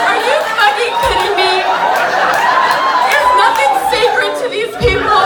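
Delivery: loud, high-pitched indignation; outraged. Retold or imitated. Stoned customer learning the candy is weed, outraged. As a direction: cry out in mock outrage.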